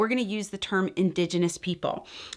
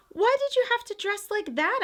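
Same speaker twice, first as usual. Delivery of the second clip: put-on whispered, high curious little girl; small child asking; 13 semitones higher than usual. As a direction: ask it in a hushed child's voice. Child asks grandmother a question.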